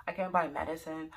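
ordinary talk

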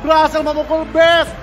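loud, high winning call; victory shout. Real, not acted. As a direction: yell the win at full voice. Caster calling the game win.

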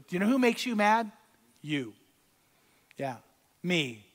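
normal talking voice

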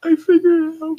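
a sudden high eureka cry; thrilled high squeak; excited realization. Real, not acted. Sudden realization while reading.

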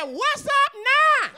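drawn-out high, loud holler; loud, high-pitched mock threat; hollered challenge. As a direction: holler a slow taunt as if out a car window. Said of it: "somebody's supposed to holler out the window".

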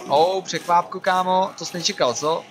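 loud gloating jeer; mock the enemy you caught off guard; loud taunting. Real, not acted. (posměšné vítězné volání)

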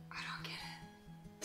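whispered aside. Real, not acted. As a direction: breathe it out unvoiced. Puzzled aside under breath.

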